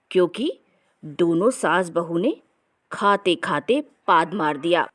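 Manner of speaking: usual speaking voice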